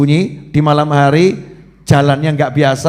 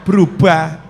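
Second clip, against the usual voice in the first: normal talking voice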